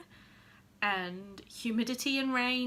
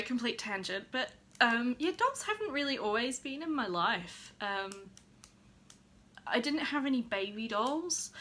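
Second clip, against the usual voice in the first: ordinary delivery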